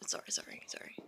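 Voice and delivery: giggly whispered apology; whispered sorry. Real, not acted. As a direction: whisper it through a laugh. Laughing apology after aside.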